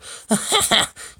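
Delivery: high burst of cackling laughter; acted high-pitched laughing fit; erupting laughter. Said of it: "erupts into a volcano of laughter".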